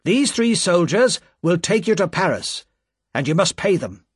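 a hard official laying down terms. A narrator's reading. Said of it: "said the official".

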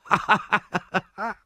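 put-on high, gleeful cackle of a boy; boyish laughter. A narrator's reading. Charlie Bates rolling on the ground, laughing high. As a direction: laugh your head off like a street urchin. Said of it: "laughed and laughed".

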